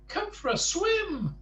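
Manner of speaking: little man calling his friend into the sea, high; high Mister Small call. Acted. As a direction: pitch it way up like a tiny man calling. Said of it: "cried Mister Small".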